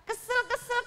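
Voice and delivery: squeaky mock whine of Kesel, kesel; cartoonish pouting king; annoyed. Sulking king.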